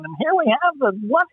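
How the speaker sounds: high, hammed-up carnival barker call; retelling his audition line as a barker. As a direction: pitch it like a fairground barker. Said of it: "he's a carnival barker going".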